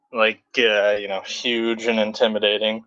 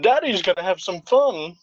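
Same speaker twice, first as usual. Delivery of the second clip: lightly voiced smug villain, a bit higher; a hint of a smug alien overlord; Team Four Star Frieza; 7 semitones higher than usual. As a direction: purr it like a gleeful cartoon tyrant. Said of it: "the Team Four Star version of Frieza".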